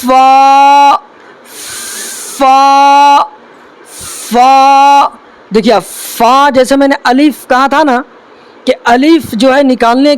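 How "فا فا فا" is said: فا said carefully with pauses between; careful recitation of فا; letter demo. Shown as a demonstration. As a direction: model فا clearly, one at a time. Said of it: "اگلا حرف کیا ہے".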